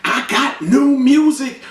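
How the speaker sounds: imitating singer plugging her music. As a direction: brag it to the camera. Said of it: "She like".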